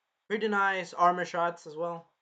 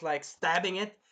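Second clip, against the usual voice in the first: ordinary delivery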